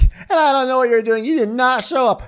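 high, loud mock scolding; mock scolding host. Host scolding a no-show guest, high and mocking. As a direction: play the upset host in a high voice.

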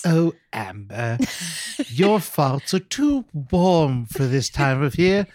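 slow, lightly voiced debutante. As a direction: drawl it like a prim debutante. Mimics Amber's debutante inner voice.

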